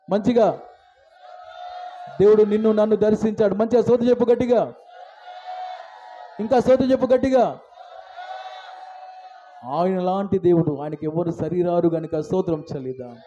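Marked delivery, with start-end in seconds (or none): 0.1-7.4 rapid-fire worship urging; urgent rallying call. Real, not acted. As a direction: fire up the crowd to praise. Urges congregation to praise louder.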